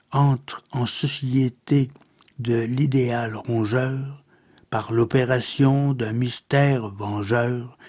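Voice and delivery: subdued, measured verse reading; poetry recitation. Acted. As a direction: recite softly, with a pause after each phrase. Recites a second poem.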